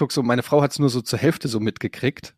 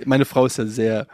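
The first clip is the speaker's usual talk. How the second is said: ordinary talk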